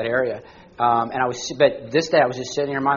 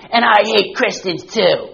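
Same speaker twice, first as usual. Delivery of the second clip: homeless woman shouting, higher and louder; a hammed-up yell at a stranger; mock yell; 8 semitones higher, 6 dB louder, slower than usual. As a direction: belt it like a street heckler. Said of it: "and she goes".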